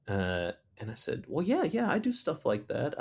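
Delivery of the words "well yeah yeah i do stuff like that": high-pitched, obliging reply; eager agreement. Retold or imitated. Muralist answers a mother's painting request, high eager agreement. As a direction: imitate yourself readily saying yes, pitched up.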